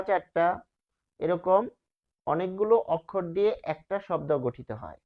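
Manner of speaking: ordinary talk, nothing marked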